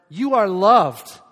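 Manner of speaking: preacher leads the church telling neighbors, loud and slow; loud declaration. Shown as a demonstration. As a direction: call it out to the person beside you. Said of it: "look at your neighbor and tell him or her".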